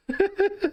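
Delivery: gargalhada curta e aguda; risada aguda. Real, not acted. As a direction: solta uma risada alta e aguda. Risada após piada do almoço. (risada aguda de quem acha graça)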